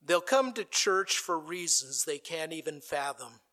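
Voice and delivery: ordinary delivery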